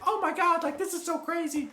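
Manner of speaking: squeaky, very high amazed voice; high-pitched amazement. Retold or imitated. Friend amazed to meet him again, in a squeaky high voice. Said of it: "And she's like".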